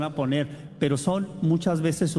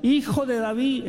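mock-shouted plea, higher; retold plea for help; 8 semitones higher than usual. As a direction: cry out to him for help. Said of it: "No nomás".

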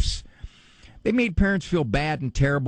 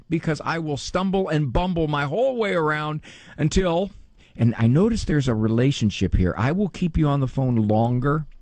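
ordinary delivery

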